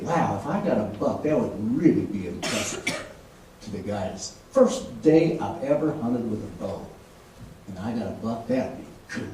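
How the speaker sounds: low, soft, dreamy murmur; musing to himself. Retold or imitated. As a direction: daydream aloud under your breath. Acting out private daydream.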